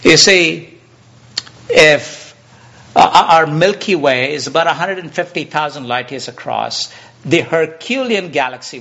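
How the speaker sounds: preacher at full volume; booming preaching. Real, not acted. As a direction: hit the hall with it. Preacher bursts out at full force.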